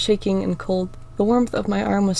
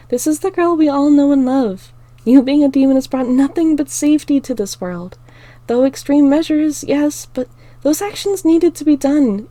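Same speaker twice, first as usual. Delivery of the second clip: higher, louder encouraging voice; Star, confident friend; 8 semitones higher, 8 dB louder than usual. Acted. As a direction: lift her up, certain and warm. Star's voice in story.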